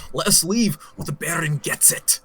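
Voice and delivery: light Scottish threat; Scottish accent. Acted. Scottish captor warns the party with a knife at the throat, Scottish accent. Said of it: "He's a Scottish".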